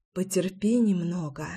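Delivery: soft, hushed whisper. A narrator's reading. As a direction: whisper it gently. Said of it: "прошептала она".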